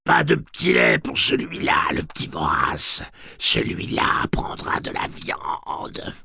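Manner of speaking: gloat, then hiss it slowly; cackling hell torturer. Acted. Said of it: "rire grinçant de la sorcière".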